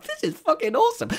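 very high, thrilled squeal; patient thrilled by the doctors' Star Wars row; delighted. Retold or imitated. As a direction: gush with sheer delight. Delighted inner reaction.

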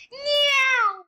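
high cat meow; squeaky playful meow; silly clown. Pretend. Silly animal noise.

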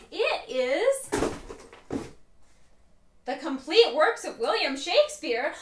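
normal talking voice

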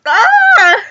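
loud crying yelp; pained cry. Real, not acted. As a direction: yelp in pain. Sour and spicy pain.

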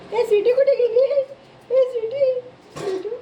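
high, loud call after the pet. Real, not acted. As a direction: shout after the runaway pet. Calling the runaway pet.

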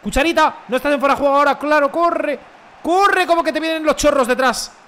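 high, loud calls to a teammate; urgent shouting. Real, not acted. Gamer to teammate running from behind pursuers, urgent shouting. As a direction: yell urgently for them to run.

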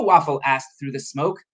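ordinary delivery, nothing marked

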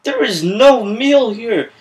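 slow, sing-song protest; indignant. Acted. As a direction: draw out each word in indignant complaint. Acted outrage over missing meal.

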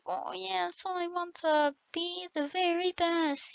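mock whiny Ash Ketchum kid, high and soft. Mocking the whiny kids on the server, whiny voice. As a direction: imitate a whiny little kid wanting to be the best. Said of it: "he had a whiny voice".

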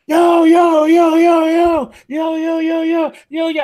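a rowdy circle pushing in shouting; hyped crowd yelling. Retold or imitated. Sneaker crowd rushes the door, loud excited yelling.

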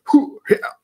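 high breathy cartoon whoop; Johnny Bravo style whew. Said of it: "Johnny Bravo at the end there".

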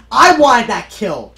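loud, slow complaint; frustrated shout. Real, not acted. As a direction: complain loudly at the screen. Shout over a lost kill.

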